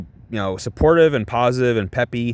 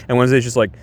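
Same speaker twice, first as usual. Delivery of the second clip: usual speaking voice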